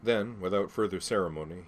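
ordinary delivery, nothing marked

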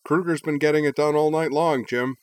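high, loud co-commentator agreeing; sports commentator. A narrator's reading. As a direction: chime in like a TV analyst. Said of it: "Karen Torborg concurred".

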